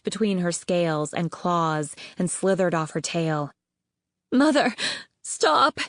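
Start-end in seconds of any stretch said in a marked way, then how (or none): 4.3-5.8 cried out. Acted. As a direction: shout in panic at her mother. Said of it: "Greatness cried".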